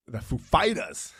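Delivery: Walken's odd emphasis on Fighters, slow; a hint of Christopher Walken; imitating Christopher Walken. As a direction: mimic Walken introducing the band. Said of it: "put the emphasis on fighters".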